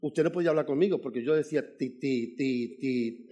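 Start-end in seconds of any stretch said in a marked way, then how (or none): 1.8-3.3 stammering ti ti ti, lightly acted; imitating his childhood stammer; stutter demo. Shown as a demonstration. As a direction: show how he used to stutter. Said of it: "yo era tartamudo".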